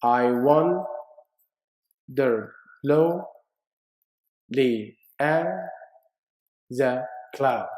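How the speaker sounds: I wandered lonely as a cloud read slowly, foot by foot; slow scanned reading. Shown as a demonstration. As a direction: count the feet as you say it. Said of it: "How many feet? You got four feet".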